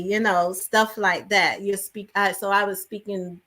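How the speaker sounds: ordinary talk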